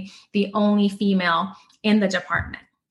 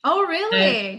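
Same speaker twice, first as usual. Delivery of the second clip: surprised laugh; 9 semitones higher, slower than usual. Real, not acted. Surprised at second accent.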